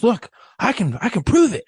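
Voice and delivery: high-pitched, lightly acted insistence; insistent drunk guy. Drunk man trying to convince a doubting priest, insistent.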